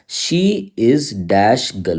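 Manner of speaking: very slow, saying "dash" for the gap; slow blank read. Shown as a demonstration. Teacher shows students the gapped sentence, very slowly. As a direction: spell out "she is dash girl" word by word, very slowly. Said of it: "देखो जैसे मैंने बोला".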